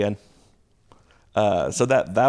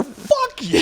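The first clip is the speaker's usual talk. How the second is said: mock shout. Student cursing from hallway.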